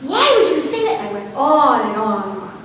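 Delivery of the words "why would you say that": high, loud, shocked scolding; a mother yelling at her son in disbelief; mother flipping out at her son, high and loud; angry yell. Retold or imitated. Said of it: "flipped out in the kitchen".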